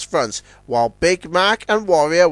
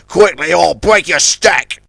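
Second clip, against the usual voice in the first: threatening; 6 dB louder than usual. A narrator's reading. Villain threatening the little tug. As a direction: make it a threat. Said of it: "threatened Johnny Cuba".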